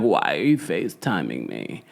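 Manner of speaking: low mocking fry voice; vocal fry. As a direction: drag it out in lazy fry. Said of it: "Don't talk to me in vocal fry".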